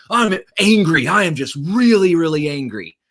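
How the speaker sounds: high, emphatic imitation of an angry man; Tony Robbins angry. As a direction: act out heated anger, easing toward the end. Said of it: "Tony was feeling really angry".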